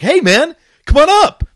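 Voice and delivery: high, loud, eager kid pilot impression; mock youngster's cheery high-pitched holler; young pilot. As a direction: call out breezily like a twenty-two-year-old. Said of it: "I see this twenty-two-year-old kid".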